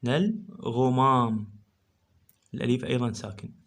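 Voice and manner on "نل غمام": slow, syllable by syllable; slow syllable reading. Shown as a demonstration. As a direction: spell out the sounds slowly. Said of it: "اللام ساكن". (قراءة بطيئة مقطعًا مقطعًا)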